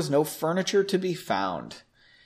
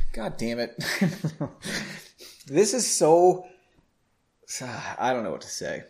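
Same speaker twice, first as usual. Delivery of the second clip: usual speaking voice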